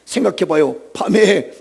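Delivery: ordinary talk, nothing marked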